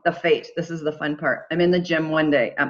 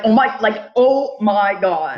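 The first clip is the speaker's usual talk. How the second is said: loud, high, drawn-out "oh my God"; thrilled; 3 semitones higher, 4 dB louder, slower than usual. Retold or imitated. Getting the TEDx acceptance email, loud stretched joy.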